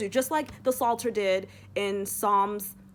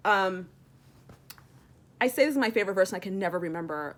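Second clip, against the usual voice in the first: usual speaking voice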